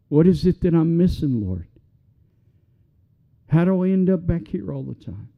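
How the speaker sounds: impassioned pulpit preaching; fervent preaching. Acted. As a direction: drive it home to the congregation. Preaches at full force.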